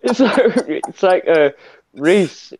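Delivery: usual speaking voice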